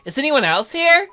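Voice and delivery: put-on high, loud, curious girl voice; perky girlish question; girl character. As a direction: ask it high and eager. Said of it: "look at that look on her face".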